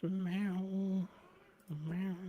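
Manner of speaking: high meow like a cat. Pretend. Owner meowing to the cat mid-sentence, high cat voice.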